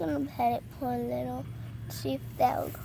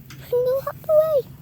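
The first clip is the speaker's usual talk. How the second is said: higher, louder and fast eager urging; excited urging; 11 semitones higher, 6 dB louder than usual. Real, not acted. Child urging the little frog to hop away, high and eager.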